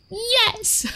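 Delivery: high-pitched delighted yes; gushing pet talk. Shown as a demonstration. Cheering on a dog being insulted, high pet-talk praise.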